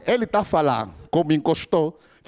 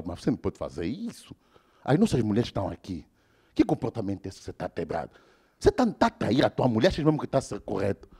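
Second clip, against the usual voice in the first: low stern reproach; 7 semitones lower, 6 dB quieter than usual. Retold or imitated. Respected friend scolds.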